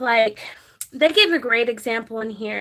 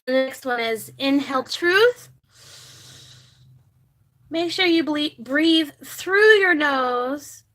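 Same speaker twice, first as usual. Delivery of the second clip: guided breathing. Acted. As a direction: guide viewers through each breath, unhurried. Leads a breathing exercise.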